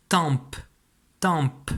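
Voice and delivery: tempe with a clearly popped final P; final P sounded. Shown as a demonstration. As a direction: dictate "tempe", releasing the P at the end. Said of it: "Tu entends bien le P de papa à la fin". (« tempe » avec le P final bien prononcé)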